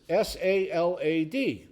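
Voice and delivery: slow spelling, each letter separate. Acted. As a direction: spell it slowly for the clerk in the joke. Joke character spells a word aloud.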